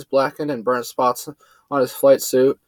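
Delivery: plain reading voice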